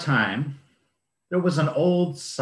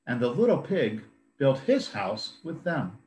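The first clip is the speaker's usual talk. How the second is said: plain reading voice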